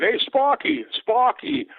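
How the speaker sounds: put-on an adoring Boston fan hollering in his accent. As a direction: yell down to the field like a thick-accented Boston fan. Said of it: "you could tell by the accent".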